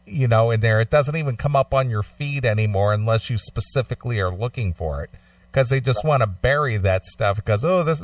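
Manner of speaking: ordinary talk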